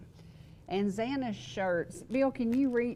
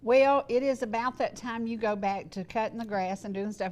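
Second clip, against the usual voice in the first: usual speaking voice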